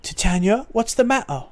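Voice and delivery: too Cockney. Acted. Concerned mercenary asking his commander, Cockney accent. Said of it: "that's too Cockney".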